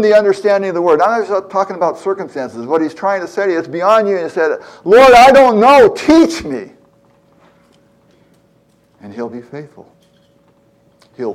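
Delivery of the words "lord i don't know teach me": high, loud, slow cry for help; desperate plea. Acted. Preacher voicing the psalmist crying out to God, high, loud and pleading. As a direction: beg the Lord to teach you, straining.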